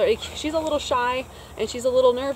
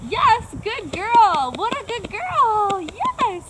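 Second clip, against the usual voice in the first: higher, louder, stretched-out praise; excited praise; 11 semitones higher, 6 dB louder, much slower than usual. Real, not acted. Trainer praising Lucy as she comes in, excited and stretched out. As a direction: cheer her on as she arrives. Praising dog after recall.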